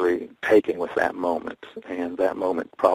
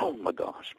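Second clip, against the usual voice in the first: stunned whisper; 10 dB quieter than usual. Retold or imitated. Sees blackjack.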